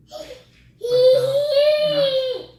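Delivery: choked up, close to tears; crying as the voice cracks high; voice breaking. Real, not acted. Father describing his son's development.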